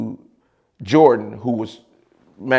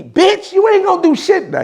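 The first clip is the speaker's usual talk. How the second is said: much higher, mock-furious JR voice; mimicking JR. JR cursing the speaker out on the phone, high mocking voice. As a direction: squeal it like an angry rival. JR's voice, heated phone threat.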